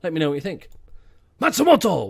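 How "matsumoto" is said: put-on loud, hammy Japanese accent on the name; showy fake Japanese accent; mock Japanese accent. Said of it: "It's nothing about Japan".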